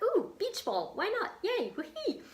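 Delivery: very high-pitched mock squeal of delight; gleeful excitement. Acting out filling holes in the tree, gleeful excitement. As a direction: cheer it like a giddy whim. Acts out her glee at decorating.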